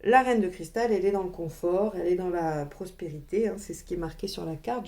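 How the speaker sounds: ordinary delivery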